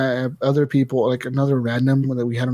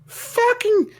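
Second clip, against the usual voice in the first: mocking shrill child's yell, much higher; screaming kid gamer; slower than usual. Mocking the kid raging over voice chat. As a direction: squeal it like an angry little kid. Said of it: "And all I hear is".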